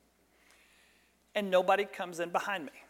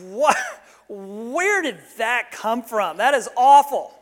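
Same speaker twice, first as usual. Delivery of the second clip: higher, louder, slow appalled exclamation; shocked at himself; 4 semitones higher, 10 dB louder, slower than usual. Retold or imitated. Pastor recoiling at his own bad thought, appalled. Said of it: "I'm thinking".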